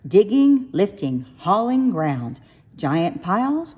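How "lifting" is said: plain reading voice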